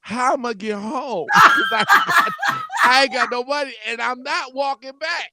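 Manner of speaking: high, loud laughing protest; stranded exasperation. Retold or imitated. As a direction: act out comic dismay with laughs breaking in. Acts out realizing he is stranded.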